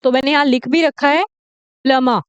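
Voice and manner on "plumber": प्लम्बर said "PLUM-uh", b silent; correct silent-b "plumber". Shown as a demonstration. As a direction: drop b and final r in प्लम्बर, "plama". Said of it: "बी silent है".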